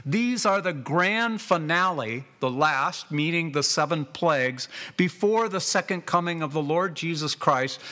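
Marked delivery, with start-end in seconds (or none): none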